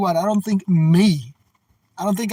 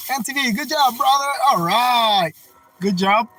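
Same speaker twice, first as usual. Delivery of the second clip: an attempt at a country boy American accent, higher; a hammed-up country boy American accent cheering a buddy on; 6 semitones higher, 3 dB louder than usual. Praising a viewer in a mock country boy American accent. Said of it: "country boy American accent".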